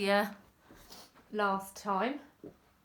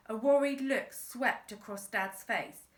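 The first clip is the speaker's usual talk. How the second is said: usual speaking voice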